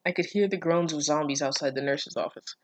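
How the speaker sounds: ordinary delivery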